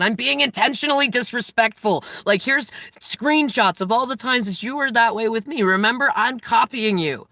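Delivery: defiant provocation. Retold or imitated. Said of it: "I said".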